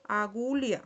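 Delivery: agulha as "a-GU-lia"; lh as l plus i. Shown as a demonstration. Teacher shows the H rule, agulha said "a-GU-lia". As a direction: model agulha with an i glide after the l. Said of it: "se pronuncia como si fuera una I".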